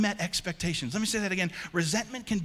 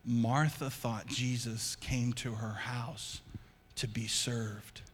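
slow and lower, ending in a whisper; slow, hushed; 6 semitones lower, 5 dB quieter, slower than usual. Shown as a demonstration. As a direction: hush it to make them lean in.

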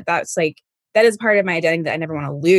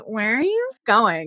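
slow, drawn-out teasing question; mock-pestering singsong; playfully annoying; 3 semitones higher, slower than usual. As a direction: needle them, stretching the words. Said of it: "'cause it's annoying him".

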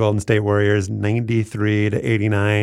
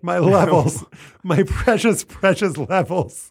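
whiny producer; 8 semitones higher, slower than usual. Retold or imitated. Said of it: "It's like".

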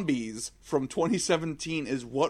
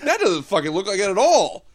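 louder scoffing exclamation; incredulous; 5 semitones higher, 7 dB louder than usual. Retold or imitated. Convention fan scoffing at a bad animatronic, loud disbelief. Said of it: "You'd be like".

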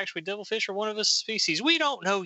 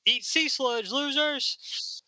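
high, jeering call; acted gloat thrown back at rivals; mocking taunt; 10 semitones higher, slower than usual. Dirk jeering at losers from the background, high taunt.

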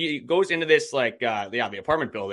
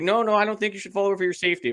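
dispatcher warning; 9 semitones higher, faster than usual. Retold or imitated.

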